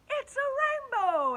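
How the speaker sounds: high, loud, slow cry of wonder; excited cry. A narrator's reading. Travellers seeing the rainbow made, excited cry. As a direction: burst out in delight. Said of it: "everyone said at once".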